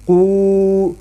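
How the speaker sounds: قو held long as qoooo. Shown as a demonstration. Teacher demonstrates the madd vowel. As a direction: stretch قو. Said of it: "یہ لین اور یہ مدہ".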